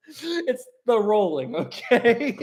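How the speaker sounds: slow, giggling words; can barely get it out for laughing; laughing through words. Real, not acted. Streamer giggling at the rolling ship.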